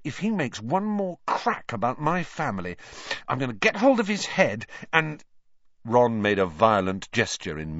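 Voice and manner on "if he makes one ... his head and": higher-pitched angry threat; worked-up indignation, cut off at the end. A narrator's reading. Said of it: "he said angrily".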